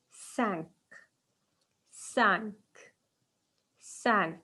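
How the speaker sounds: cinq said as a nasal sa with no k; model pronunciation. Shown as a demonstration. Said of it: "we say".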